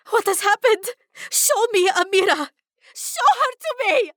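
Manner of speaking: very high, loud frantic scream. Acted. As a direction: scream it, demanding to see the baby. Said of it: "I scream".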